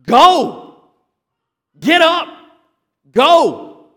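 loud, high urgent shout; go, get up, as a shouted order; exclamation-point command. Shown as a demonstration. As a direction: bark it like a sharp command. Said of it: "think with an exclamation point".

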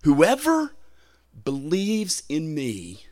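high, ringing "Whoever", then slow "believes in me"; bearing down emphatically. Retold or imitated. Preacher voicing Jesus' promise to all believers, emphatic. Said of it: "Jesus is bearing down with emphasis on this".

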